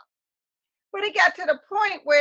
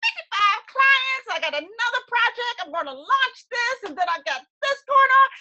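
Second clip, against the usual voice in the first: high, loud, overjoyed squeal of good news; excited gush; 10 semitones higher, 3 dB louder than usual. Retold or imitated. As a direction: gush like the clients keep pouring in. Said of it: "and I was like".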